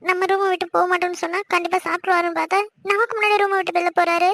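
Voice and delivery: usual speaking voice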